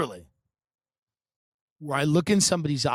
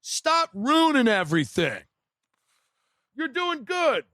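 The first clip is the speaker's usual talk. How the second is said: dismissive crowd; 9 semitones higher, 4 dB louder, slower than usual. Retold or imitated. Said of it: "people are like".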